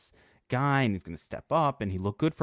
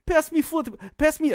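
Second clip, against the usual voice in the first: mock European accent; 14 semitones higher than usual. Imitating Porzingis calling to Doncic in a mock European accent. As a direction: ham up a young European teammate. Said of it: "That's a great impression".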